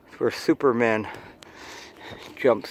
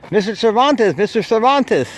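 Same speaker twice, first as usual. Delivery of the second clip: higher, louder mock call of a kid; kid yelling; 9 semitones higher, 5 dB louder than usual. Kid at Halloween calling to Edgar, higher mock yell. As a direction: call out excitedly like a child spotting someone. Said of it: "the kid saw you and yelled".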